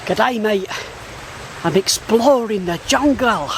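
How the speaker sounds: a cheery Australian bushman greeting; Australian accent. Pretend. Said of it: "That was my Australian accent".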